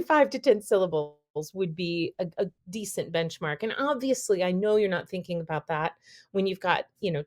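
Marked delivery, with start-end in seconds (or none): none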